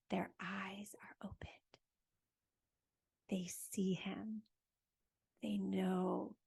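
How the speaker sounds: slow, soft whisper; hushed whisper. Acted. Storyteller hushing the children at the reveal, slow whisper. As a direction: breathe it out in awe, slowly.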